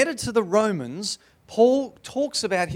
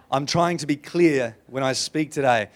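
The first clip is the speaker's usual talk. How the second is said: ordinary talk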